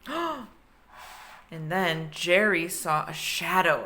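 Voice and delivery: ordinary delivery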